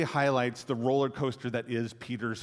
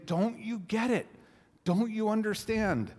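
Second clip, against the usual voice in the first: higher, pleading disbelief at the disciples; exasperated; 8 semitones higher than usual. Retold or imitated. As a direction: let the frustration rise into the question. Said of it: "you hear the exasperation that he has".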